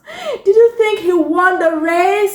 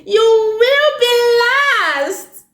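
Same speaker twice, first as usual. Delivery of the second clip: high, slow sing-song teasing; taunting racer voice; 7 semitones higher than usual. Acted. Racer teasing Terrence before the race, high sing-song taunt. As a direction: tease him that he'll lose.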